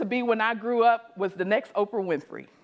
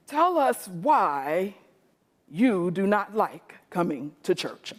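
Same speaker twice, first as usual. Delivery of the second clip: very slow, lower, formal; distinguished moderator; 4 semitones lower, slower than usual. Retold or imitated. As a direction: speak like a stiff official. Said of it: "in his most distinguished self".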